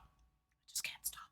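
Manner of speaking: breathy whispered repeat. Real, not acted. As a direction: drop to a slow hush. Whisper after plain take.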